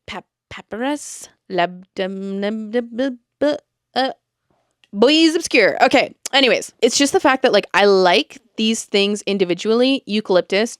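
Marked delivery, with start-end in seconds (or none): 0.1-5.8 stumbling, slowed over papyrus and labdanum; fumbling pronunciations. Retold or imitated. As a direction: struggle through each strange name. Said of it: "'cause I'm like".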